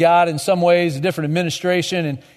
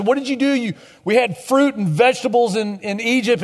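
raised, indignant Israelite, lightly acted; indignant complaint; 6 semitones higher than usual. Israelites accusing God over the food of Egypt, indignant. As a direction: demand it of God, higher and accusing. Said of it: "they look at God and they say".

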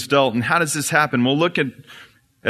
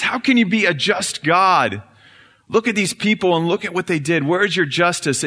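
Satan demanding; 6 semitones higher than usual. Acted.

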